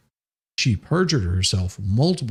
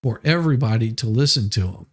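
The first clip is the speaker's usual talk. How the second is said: usual speaking voice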